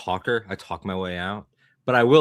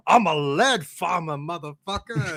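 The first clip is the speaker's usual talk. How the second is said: movie quote; 6 semitones higher than usual. Retold or imitated. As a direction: bark it like the movie line. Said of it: "and he goes".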